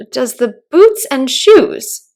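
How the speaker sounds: slow, loud, puzzled echo; wondering. Acted. Alice echoes the Gryphon's riddle in a wondering tone. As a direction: repeat it, baffled. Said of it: "she repeated in a wondering tone".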